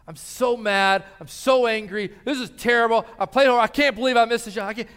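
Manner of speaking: a father imitating his son's furious rant; furious kid. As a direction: voice the son storming off the court. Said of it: "He's just furious 'cause the team lost".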